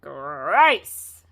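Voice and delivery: loud, revolted outburst; disgusted cry. A narrator's reading. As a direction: spit it out in disgust. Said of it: "they all cried".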